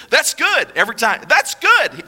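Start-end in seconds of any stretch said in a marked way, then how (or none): 0.1-0.7 high, chirpy optimist; cheerily upbeat, acted; super optimistic. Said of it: "just super optimistic. He goes". 1.3-2.0 high, chirpy optimist; cheerily upbeat, acted; super optimistic. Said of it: "just super optimistic".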